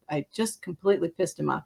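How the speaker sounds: ordinary talk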